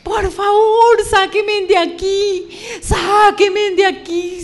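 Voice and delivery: high, slow, tearful pleading yells; desperate cry. Retold or imitated. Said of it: "yo empecé a gritar". (súplica desesperada, aguda, lenta y llorosa)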